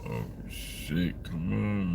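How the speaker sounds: usual speaking voice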